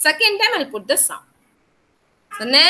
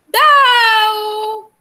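calling out; 7 semitones higher, 5 dB louder than usual. Acted. Teacher calls out title dramatically.

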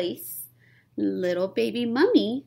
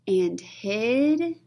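plain reading voice

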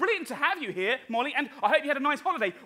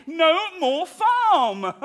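slow dramatic pronouncement; 3 semitones higher, 4 dB louder, much slower than usual. Acted. Slow repeat.

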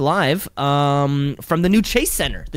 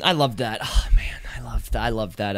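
hushed whisper of delight; 6 semitones lower than usual. Real, not acted. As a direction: breathe the words, savoring them. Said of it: "whispered repeats".

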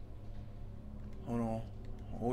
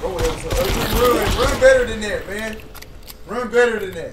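1 mocking taunt; 6 semitones higher, 10 dB louder than usual. Real, not acted. Taunting fleeing enemy.

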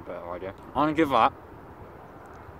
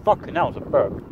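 ordinary talk

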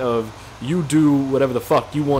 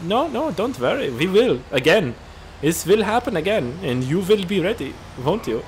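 high, menacing mock German deer; German accent; 6 semitones higher than usual. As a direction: promise trouble in a put-on German accent. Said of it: "I don't know why they're German".

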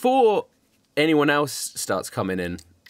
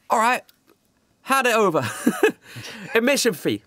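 pushy doorkeeper; 9 semitones higher than usual. Acted. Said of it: "Doxy is still at the front door, like".

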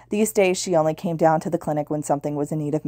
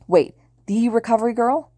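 put-on high, thrilled "the Recovery Girl?"; excited disbelief; 6 semitones higher than usual. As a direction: gasp it out like a starstruck fan. Fan hears hero's name.

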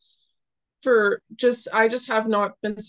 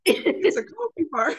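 slow, higher, words dissolving into laughter; laughing; 11 semitones higher than usual. Real, not acted. Storyteller explaining her coffee shop mistake, laughing hard. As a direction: giggle through the line, then crack up. Still laughing over the mix-up.

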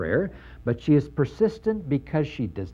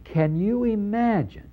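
higher, slow, disbelieving question; incredulous challenge; 8 semitones higher than usual. Retold or imitated. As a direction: dare them to picture it. Preacher mimics Jesus' incredulous question.

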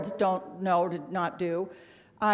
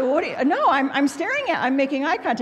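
loud, higher-pitched mock protest; indignant protest; 6 semitones higher, 7 dB louder, faster than usual. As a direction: protest loudly, stumbling over your own defence. Acted protest to the doctor.

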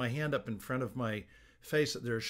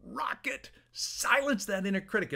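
high-pitched, pumped-up cheer; excited pep-talk send-off; rousing sign-off; 9 semitones higher than usual. Real, not acted. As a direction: cheer them on, pitch leaping up.

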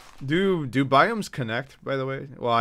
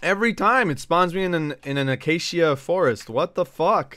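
frustrated complaint; 5 semitones higher, 5 dB louder than usual. Real, not acted. Frustrated with repeated spawn.

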